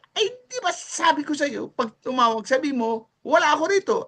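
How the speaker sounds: high, exasperated boss voice; annoyed boss. Retold or imitated. The captain waiter scolding the young waiter for telling the truth, pitched high.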